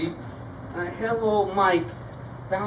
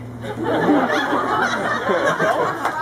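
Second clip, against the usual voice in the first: breathy higher laugh; 7 semitones higher than usual. Real, not acted. Uncle laughs during wedding toast, breathy laugh. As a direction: laugh at his own joke.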